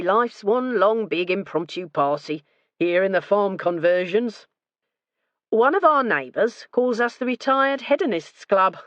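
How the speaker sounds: cheerful boast about retirement; lightly voiced jovial husband. A narrator's reading. Husband cutting in to boast to the inspector, lightly jovial.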